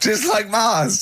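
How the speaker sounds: slow, high words breaking into a laugh; laughing while talking. Real, not acted. Joking that the island is like Mars, laughing. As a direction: let the laugh spill through the line.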